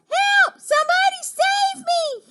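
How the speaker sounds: very high, loud distress call; shouting for help. Acted. Stranded frog in great waves, shouting for help. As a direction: shriek for rescue at the top of the range. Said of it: "he shouted".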